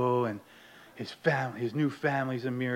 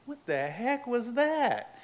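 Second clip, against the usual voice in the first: high, slow, puzzled question; baffled; 10 semitones higher, 4 dB quieter, slower than usual. Retold or imitated. Newcomer hearing a strange noise on the steps, baffled. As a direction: draw it out in mock bewilderment.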